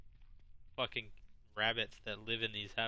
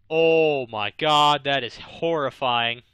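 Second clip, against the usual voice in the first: loud, slow horrified cry; horrified outburst; 2 semitones higher, 15 dB louder, slower than usual. Real, not acted. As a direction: drag out the horror, loud. Reacting to horrifying creature.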